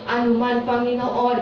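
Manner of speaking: normal talking voice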